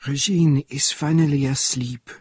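lightly voiced old Frenchman speaking confidentially; a hint of a whisper from an old Frenchman; hushed old Frenchman. A narrator's reading. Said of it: "he whispered".

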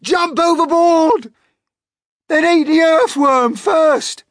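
very loud, high shout; frantic shouting. Acted. Centipede yells as the Cloud Man climbs down, shouting. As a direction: shout at full pitch in panic. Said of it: "shouted the centipede".

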